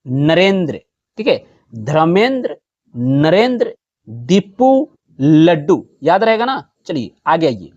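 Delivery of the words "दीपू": ordinary talk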